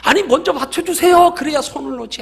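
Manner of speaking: high-pitched pleading, loud at first; acted frantic protest. Climber insisting God hold him first, acted frantic protest. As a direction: argue back in mock desperation.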